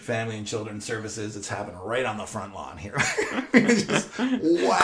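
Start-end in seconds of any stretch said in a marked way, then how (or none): 3.0-4.8 laughing. Real, not acted. Laughing retelling the hit.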